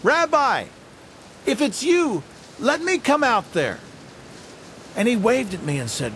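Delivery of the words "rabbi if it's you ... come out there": high, eager call across the water; yelling out. Retold or imitated. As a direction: shout out high to the rabbi on the waves. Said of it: "I heard myself yell out".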